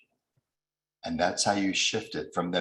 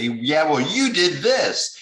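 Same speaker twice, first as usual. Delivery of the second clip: higher, louder, slow defensive retort; angry comeback; 9 semitones higher, 8 dB louder, slower than usual. Retold or imitated. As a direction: snap back like you're cornered. Acted fight-back in an argument.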